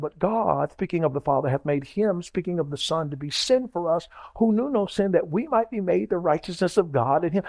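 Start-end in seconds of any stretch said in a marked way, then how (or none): none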